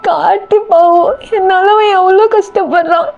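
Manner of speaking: high, slow, drawn-out wail; tearful high-pitched lament; acted sobbing. A narrator's reading. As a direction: sob the words out helplessly. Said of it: "தேம்பி தேம்பி அழுதுட்டே இருக்கா".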